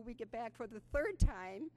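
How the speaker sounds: ordinary talk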